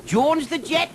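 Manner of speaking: high, loud boastful cry; proud cry. Acted. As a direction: announce your nickname with pride. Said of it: "he cried".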